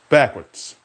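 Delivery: usual speaking voice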